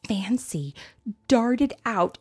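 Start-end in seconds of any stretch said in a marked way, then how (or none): none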